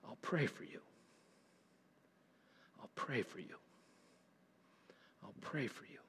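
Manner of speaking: very soft, low, earnest promise; hushed intensity. Shown as a demonstration. Preacher showing how to promise prayer, hushed and intense. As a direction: say it with quiet intensity, nearly whispered. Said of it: "say that with intensity".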